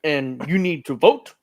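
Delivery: measured politician's voice, set apart in deliberate phrases; Barack Obama. Acted. Host doing his best Obama for co-hosts, presidential impression. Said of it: "that's my best Obama impression".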